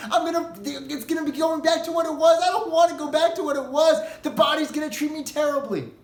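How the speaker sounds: pleading soul. Acted.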